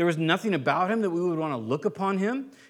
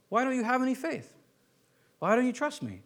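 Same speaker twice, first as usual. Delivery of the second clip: softer, gentle asking; kind and gentle; 5 semitones higher, 4 dB quieter than usual. Retold or imitated. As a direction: ask it gently, without blame. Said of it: "Isn't that kind and gentle?".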